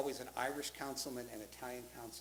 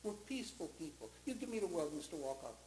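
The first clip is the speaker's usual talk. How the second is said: Luigi Balducci; 3 semitones higher than usual. Retold or imitated. Eighty-five-year-old Italian phoning Mister Walkoff about mafia slur, earnest pleading. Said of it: "my name is Luigi Balducci. I'm eighty-five years old".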